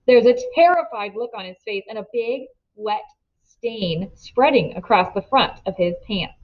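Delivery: ordinary delivery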